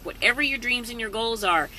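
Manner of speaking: ordinary delivery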